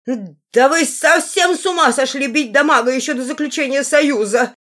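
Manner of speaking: high, loud hysterical squawking; hysterical parrot. A narrator's reading. Parrot protesting the beating before the alliance, hysterical. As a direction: wail like an outraged parrot. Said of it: "истерически вопил попугай".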